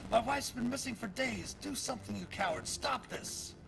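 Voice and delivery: high, frantic yell at the door; desperate yell. Acted. Husband holding his wife's sketch, yelling at the door, frantic and high. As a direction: shout accusingly, at the end of his rope.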